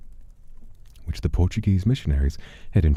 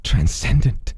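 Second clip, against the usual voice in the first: fanatical pleading man; 13 semitones higher, slower than usual. Acted. Desperate man promises to spread the demon's name, high and fevered. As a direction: gush it all out in fevered devotion.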